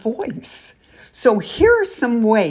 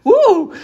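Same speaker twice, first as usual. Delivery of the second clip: excited woo; 13 semitones higher, 9 dB louder than usual. Real, not acted. Cheering over follower goal.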